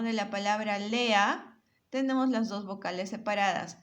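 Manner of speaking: normal talking voice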